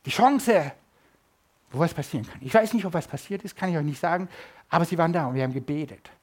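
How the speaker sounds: ordinary delivery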